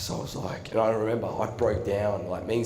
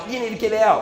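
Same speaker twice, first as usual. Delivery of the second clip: angry order; 8 semitones higher, 5 dB louder, faster than usual. Retold or imitated. Principal ejecting him before members.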